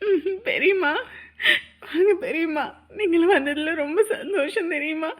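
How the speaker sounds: happy tears while greeting; tearful welcome. Acted. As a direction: welcome the aunt through sniffles and sobs. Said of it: "அழாத". (ஆனந்தக் கண்ணீருடன் வரவேற்கும் குரல்)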